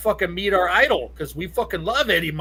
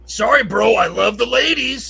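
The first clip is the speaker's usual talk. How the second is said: higher, louder cocky rock star voice; 6 semitones higher, 7 dB louder than usual. Retold or imitated. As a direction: toss it off like a smug rocker who loves the ladies. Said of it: "[name] looks at me and goes".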